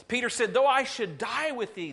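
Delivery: high, loud declaration of loyalty; loud vow. Acted. Peter insisting to Jesus he'll die with him, loud and high. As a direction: belt out the promise.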